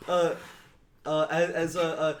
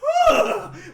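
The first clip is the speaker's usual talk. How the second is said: big dramatic exhale, high and loud; bugbear's long astonished sigh; 10 dB louder than usual. Acted. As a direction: let out a huge wondering sigh.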